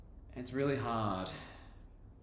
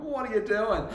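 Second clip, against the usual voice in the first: high, loud, laughing what are you doing; laughing disbelief; 12 semitones higher, 8 dB louder than usual. Retold or imitated. Teacher laughing at the student, high loud disbelief. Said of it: "I'm just laughing at him going".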